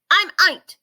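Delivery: high, loud happy shout. A narrator's reading. Ike shouting his name down to the rhino. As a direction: shout your name down, proud. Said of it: "shouted Ike".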